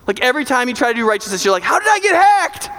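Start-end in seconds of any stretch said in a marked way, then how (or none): 1.6-2.7 baffled cry. Acted. Baffled self after failing.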